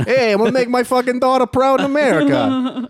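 high, loud Eastern European dad; proud immigrant father, hammed up; immigrant parent accent.

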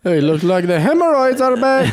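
put-on high, loud Mexican accent; doctor in a Mexican accent. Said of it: "Why is he Mexican?".

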